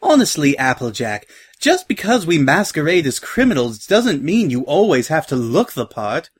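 refined mare, put-upon; genteel, chiding Rarity. Acted. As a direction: chide a friend with prim exasperation. Voices Rarity chiding Applejack.

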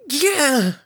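high piercing shriek of pain. A narrator's reading. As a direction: cry out as you're struck mid-air. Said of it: "Harmony's shriek echoed".

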